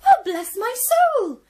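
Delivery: high, loud exclamation; astonished cry. Acted. Piglet two amazed to see real Granny, high. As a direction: gasp it out in amazement. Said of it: "cried Piglet two".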